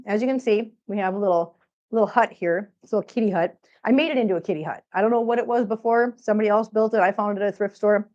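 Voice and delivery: usual speaking voice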